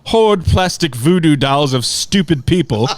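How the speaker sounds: mock-formal London uncle; butchered London accent. Uncle writes back to Corey on Facebook, butchered London accent. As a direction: put on a lofty, bad London accent. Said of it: "I'm sure I will butcher his accent".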